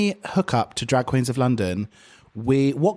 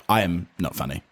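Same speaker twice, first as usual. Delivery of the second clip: mock flat read; 9 semitones lower than usual. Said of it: "just be like".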